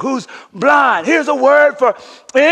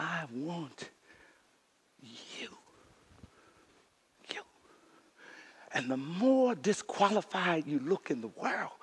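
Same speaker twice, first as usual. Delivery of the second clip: hushed preaching; 7 semitones lower, 18 dB quieter than usual. Acted. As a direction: draw them in quietly. Soft, intimate preaching passage.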